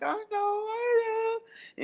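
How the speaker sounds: mock-tearful, high drawn-out whine; whiny reply. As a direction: whimper it slowly, imitating a teary grandma. Retold tearful reply to Reed.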